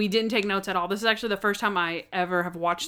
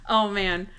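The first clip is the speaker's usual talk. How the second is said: ordinary talk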